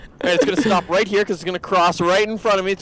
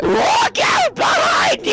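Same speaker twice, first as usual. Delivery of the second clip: alarm shout. Real, not acted. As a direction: shout to warn your partner. Storm chasing game, warning partner.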